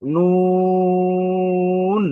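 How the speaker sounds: নুন with a very long, loud, steady oo; drawn-out letter name. Shown as a demonstration. Teacher models the letter's name, long-drawn. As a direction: hold the oo of নুন high and even. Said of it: "আমরা এটাকে দীর্ঘ টান দিতে পারি".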